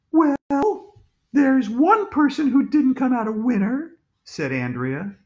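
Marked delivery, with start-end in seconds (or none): 0.1-3.9 high-pitched schoolgirl voice; pointed girl. A narrator's reading. Andrea corrects the principal, high and pointed. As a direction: make the point in a high girl's voice.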